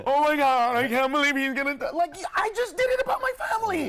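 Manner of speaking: high, loud outraged gasp at a joke; mock offended audience. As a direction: play the easily offended listener, shocked.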